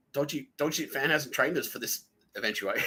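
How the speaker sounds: normal talking voice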